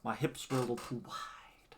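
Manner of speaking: whispered embarrassed aside. Real, not acted. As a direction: whisper it like a confession. Embarrassed aside after struggling into tights.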